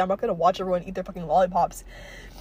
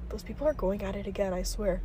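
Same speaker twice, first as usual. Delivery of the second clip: much softer, under the breath; hushed aside; 2 semitones lower, 10 dB quieter than usual. Real, not acted. Vlogger grumbling about neighbours, hushed. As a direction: drop the voice to a private mutter.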